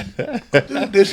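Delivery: drawn out through laughter; slow laughing. Real, not acted. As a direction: stretch it slowly while laughing. Slowed repeat while demonstrating.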